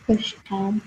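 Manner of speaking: ordinary delivery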